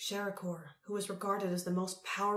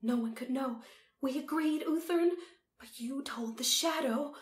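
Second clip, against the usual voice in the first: high-pitched, accusing Empress; 7 semitones higher, 3 dB louder than usual. A narrator's reading. Empress accuses her brother.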